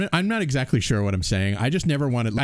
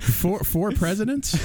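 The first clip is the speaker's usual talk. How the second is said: high, slow incredulous question; stunned by the number; surprised; 11 semitones higher, slower than usual. Real, not acted. Surprised by four presidents.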